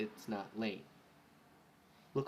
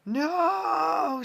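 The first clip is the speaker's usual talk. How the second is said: high, loud cry of loss; despairing shriek; anguished scream; 11 dB louder than usual. Acted. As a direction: scream it as loud as you can. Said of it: "she called out as loud as she could".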